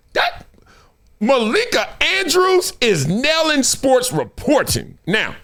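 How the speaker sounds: usual speaking voice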